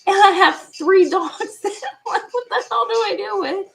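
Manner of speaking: high, laughing disbelief; laughing exasperation. Real, not acted. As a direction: tell it through helpless laughter. Laughing over the retold predicament.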